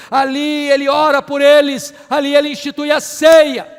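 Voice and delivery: driving, rhythmic pulpit litany swelling to a loud peak; building preaching. Real, not acted. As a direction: pile up each phrase with mounting fervour.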